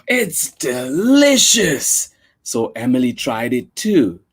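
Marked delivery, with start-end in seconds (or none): 0.1-2.1 slow, high, drawn-out delicious; savoring delight. Acted. Emily's mom tasting kimbap, savoring slowly. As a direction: relish the first bite.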